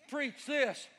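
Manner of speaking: usual speaking voice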